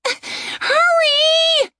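high, crying urgent yell; frantic shout. Acted. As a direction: shout it through panicked tears. Panicked woman urging skeleton.

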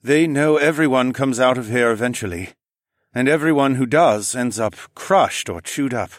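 put-on almost robotic French-accented man; exhausted, shaken engineer. A narrator's reading.

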